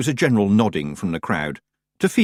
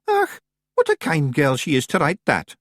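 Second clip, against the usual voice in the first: a hint of high Scots wee man; fond Scots pictsie, lightly done; Billy Big Chin praising the letter, lightly voiced; 7 semitones higher than usual.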